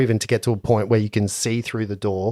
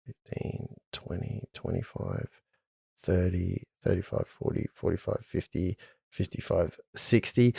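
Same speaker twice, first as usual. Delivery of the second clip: counting under breath; 6 semitones lower, 13 dB quieter than usual. Real, not acted.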